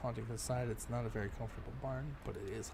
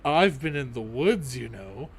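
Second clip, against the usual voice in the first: ordinary talk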